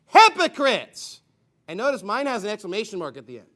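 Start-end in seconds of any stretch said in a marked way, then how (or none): 0.1-1.2 loud, slow condemning cry; thundered rebuke. Acted. Preacher voicing Christ's rebuke to the Pharisees, thundering. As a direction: shout it out, high and drawn out.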